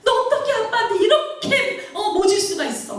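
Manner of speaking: acted anguished telling-off; grandmother's scolding. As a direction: reproach climbing to a high-pitched outcry. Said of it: "할머니가 야단을 쳤을 때".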